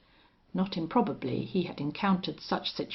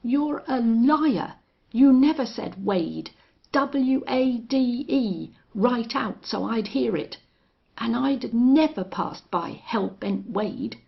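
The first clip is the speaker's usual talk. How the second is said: loud, slow furious accusation; 6 semitones higher, 6 dB louder than usual. Acted. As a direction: spell the name out in rage. Rustler raging at Belllounds.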